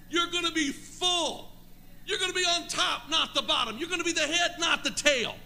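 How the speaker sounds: high, ringing preached promise; rising sermon promise. Acted. Pastor voicing God's promise to the church, high and ringing.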